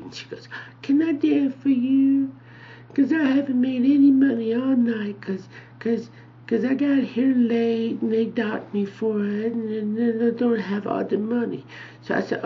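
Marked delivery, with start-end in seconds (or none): 0.8-11.6 put-on sweet girl. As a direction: plead sweetly in a thin high pitch. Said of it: "she was really sweet".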